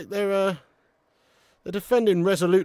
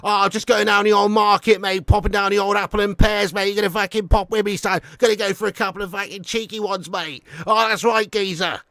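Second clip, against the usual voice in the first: put-on broad, chummy cockney accent; cockney geezer; 3 semitones higher, 4 dB louder than usual. Greeting an old mate down the market, hammed-up cockney. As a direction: lay on the London geezer accent.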